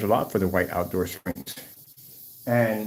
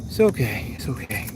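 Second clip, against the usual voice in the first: soothing the bird. Real, not acted.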